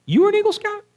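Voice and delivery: very high, loud astonished question; surprised. Real, not acted. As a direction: shoot up high in disbelief. Amazed at a listener's answer.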